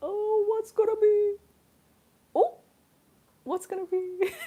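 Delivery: high, held teasing tones; singsong suspense. Real, not acted. Streamer making viewers guess the snack, singsong suspense. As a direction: tease the reveal in a rising singsong.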